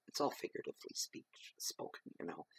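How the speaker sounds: soft unvoiced whisper; whispered aside. Real, not acted. Whispered aside after a metaphor.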